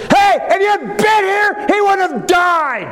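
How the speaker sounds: high, drawn-out cry of reproach; reproachful shout. Acted. Mary and Martha reproach Jesus.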